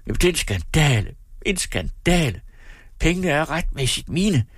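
high-pitched, indignant rant; worked-up, outraged cry; angry outburst. A narrator's reading. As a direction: rage at the injustice, pitch climbing. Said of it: "råbte han".

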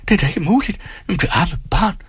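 high, appalled exclamation; high voice of distressed disbelief; shocked dismay. Acted. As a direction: react aghast to the collapse. Bystanders shocked at her collapse.

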